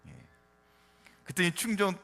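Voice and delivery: usual speaking voice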